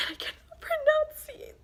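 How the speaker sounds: high, slow, breathy whine of frustration; near-tearful complaint; frustrated whine. Real, not acted. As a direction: whine it like you're fed up. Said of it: "it's driving me crazy".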